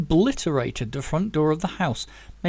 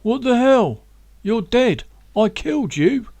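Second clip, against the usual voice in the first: higher, louder, shaken disbelief; trembling; 7 semitones higher, 6 dB louder than usual. Acted. Tommy seeing Zack alive, trembling and higher. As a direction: blurt it out, rattled and scared.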